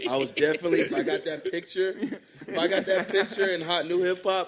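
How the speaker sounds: laughing through the words, loud and high. Real, not acted.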